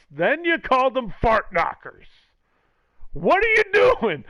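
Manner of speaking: a principal losing it at a teacher, hammed up; mock principal outburst. Principal scolding a teacher, mock shouting. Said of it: "Imagine the meeting when the principal's like".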